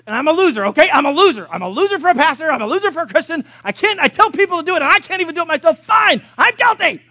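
high, loud frustrated rant at himself; high, loud exasperated self-scolding; self-berating outburst. Retold or imitated. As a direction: yell out your own failure, pleading. Pastor berating himself to God.

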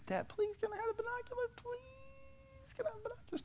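put-on high pleading kid voice, on the edge of tears; whiny child begging. Small boy begging his dad for binoculars, high whine. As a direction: beg like a seven-year-old who wants it badly.

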